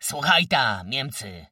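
loud roar, starting high and dropping. A narrator's reading. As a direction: roar a threat at the enemy. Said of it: "ryknął, wyciągając pięście".

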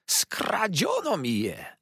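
high, drawn-out cry of outrage; outraged cry. A narrator's reading. Villain discovering he has been robbed, high outraged cry. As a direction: wail it high, dragging the words. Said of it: "zawołał, mieniąc się straszliwie na twarzy".

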